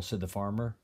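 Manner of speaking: ordinary delivery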